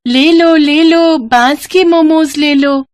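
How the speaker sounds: high, loud, slow hawker's call; street hawker call. Acted. Girl selling bamboo momos calling to customers, loud slow call. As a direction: call out to the whole market.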